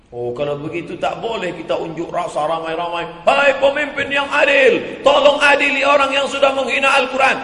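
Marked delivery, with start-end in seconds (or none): 3.2-7.4 crowd shouting. Acted. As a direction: call out to the leader in outrage. Protesters crying out to the leader.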